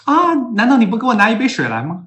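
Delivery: high, loud coaxing question; mock South African wife asking for water; indirect request as question. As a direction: ask sweetly but mean it as an order. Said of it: "南非人说话都是".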